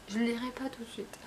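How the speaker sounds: aparté soufflé, presque sans voix; glisse-le en confidence; chuchoté. Real, not acted. (chuchoté en aparté aux spectateurs)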